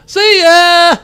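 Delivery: high, loud send-off; gleeful shout. Acted. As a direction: call it out high and loud, laughing into it. Gleeful call as character leaps off.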